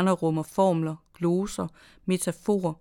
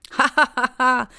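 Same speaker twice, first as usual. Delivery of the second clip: high, loud ha-ha-ha burst of laughter; loud gleeful prankster laugh; loud mocking laugh; 7 semitones higher, 8 dB louder than usual. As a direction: cackle it out like a prankster springing his trap. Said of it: "lo han".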